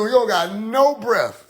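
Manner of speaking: normal talking voice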